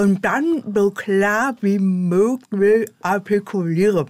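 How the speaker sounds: speaking with lips tucked over teeth; lips rolled over teeth. Shown as a demonstration. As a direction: talk with rolled-in lips, slowly. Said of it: "Lippen einrolle über die Zähne".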